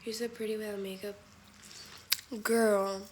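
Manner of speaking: ordinary delivery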